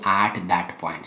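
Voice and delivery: "at" drawn out and set apart before "that point"; stress on AT. Shown as a demonstration. Teacher stressing a key phrase to students, "at" drawn out. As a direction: lean on "at", holding the vowel long. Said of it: "I'm stressing the word".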